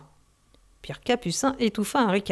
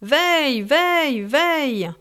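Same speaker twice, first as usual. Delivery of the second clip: calling out; 6 semitones higher, slower than usual. A narrator's reading. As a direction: sing out the lead-line call across the deck. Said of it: "en criant".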